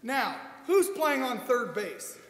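ordinary delivery

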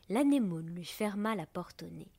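plain reading voice

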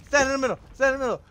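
high, loud urgent command; urgent shout. Real, not acted. Gamer under attack urging himself on, high and loud. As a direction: bark it to yourself in a hurry.

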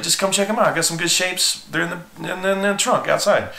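high, breezy hustler's pitch; sales pitch. Retold or imitated. Teen hustler luring a customer outside, eager pitch. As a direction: sell it, keep it light and eager.

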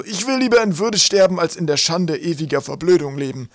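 high, loud, agitated scholar; impassioned professor's lament; despairing old professor. Acted. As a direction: declaim it high with wounded dignity. Narrator plays the despairing professor.

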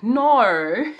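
"No" said loud and high in an Australian accent. Shown as a demonstration. Vlogger shows how she now says no, Australian accent. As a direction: draw out "No" the Australian way. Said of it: "I'd be like".

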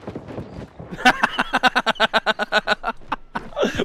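loud high burst of laughter; cracking up, breathless laugh. Real, not acted. Streamer cracks up at teammate.

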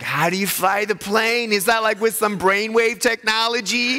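lightly voiced child asking the pilot; curious kid. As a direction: ask it like an amazed little kid. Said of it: "the kids are like so excited".

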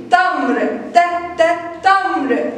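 تمر, then ت as short separate syllables, then تمر; first sound isolated. Shown as a demonstration. Teacher showing which word starts with the letter, sound isolated. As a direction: break ت off the front of تمر with gaps. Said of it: "الكلمة اللي بتبدأ يا كي جي بتاء فتحة".

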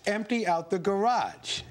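normal talking voice

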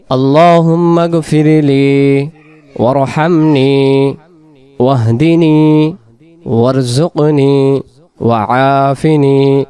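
slow, drawn-out dua, each word held; slow recitation for memorizing. Shown as a demonstration. Teacher recites the dua slowly so students memorize it. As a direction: recite it slowly so listeners can repeat. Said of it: "মুখস্থের জন্য আমি কয়েকবার উচ্চারণ করে দিতেছি".

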